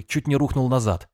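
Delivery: ordinary delivery, nothing marked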